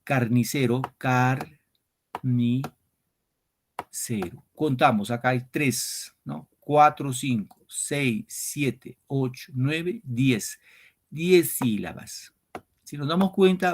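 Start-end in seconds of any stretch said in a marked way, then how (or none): 0.0-2.8 carnicero split into slow syllables kar-ni-se-ro. Shown as a demonstration. 6.6-12.3 cuatro, cinco, seis counted slowly; slow syllable counting. Shown as a demonstration. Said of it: "counting syllables".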